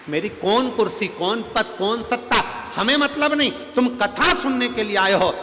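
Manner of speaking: high, loud preaching; preaching at full force to the assembly; fiery preaching. Acted. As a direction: drive the point home to the crowd.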